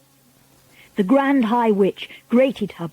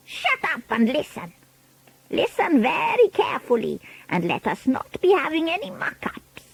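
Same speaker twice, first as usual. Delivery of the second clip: put-on higher, accented, imperious witch voice; Grand High Witch; 6 semitones higher, 4 dB quieter than usual. A narrator's reading. Witch leader demanding silence from her followers, imperious voice. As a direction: give orders as a commanding villain.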